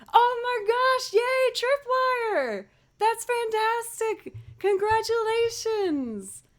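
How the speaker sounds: squealing with delight; excited congratulations. Real, not acted. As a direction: gush with real joy for a friend's news. Congratulating a viewer on new job.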